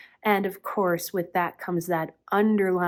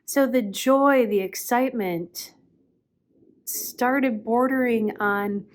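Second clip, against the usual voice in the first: usual speaking voice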